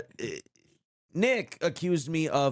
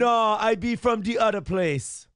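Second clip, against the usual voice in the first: slow, louder Jamaican patois; 6 semitones higher, 4 dB louder, slower than usual. Acted. Denying to his cohost, slow loud patois. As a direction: roll it out slow in a patois lilt. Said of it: "I have to speak in patois".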